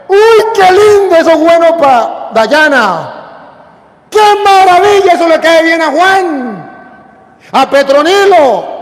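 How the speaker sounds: high, drawn-out gushing; mock admiration. Priest mimics churchgoers passing the word to others, mock admiration. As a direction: gush loudly, stretching every phrase, pitch gradually easing down.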